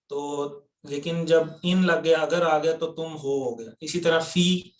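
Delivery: ordinary delivery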